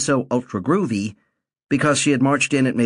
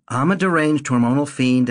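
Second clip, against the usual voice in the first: plain reading voice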